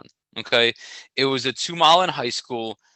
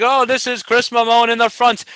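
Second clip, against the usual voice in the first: high, loud mock-British race commentary; hammed-up British announcer, calling the leader; British announcer accent; 10 semitones higher, 6 dB louder than usual. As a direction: put on a bad British accent and project it. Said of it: "the British announcer is talking up like crazy".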